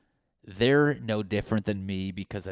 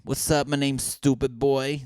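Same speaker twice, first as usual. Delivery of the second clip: higher mock rapper introduction; failed rapper; 5 semitones higher, 4 dB louder than usual. Failed rapper greeting an audience, mock swagger. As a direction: play a hapless rapper announcing himself. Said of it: "sounds like a failed rapper name".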